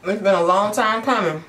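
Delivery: talking while chewing food; speaking around a mouthful; mouth full, chewing. Real, not acted. Seen 0: Food put in mouth at -2; lips move chewing while speaking.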